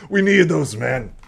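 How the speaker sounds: loud, high insistence; loud dismay. Acted. Commander laments the lost men, loud and exasperated.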